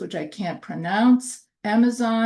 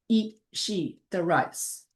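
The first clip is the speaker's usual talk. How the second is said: slow, softer; 2 semitones lower, 4 dB quieter than usual. Shown as a demonstration. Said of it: "So that becomes".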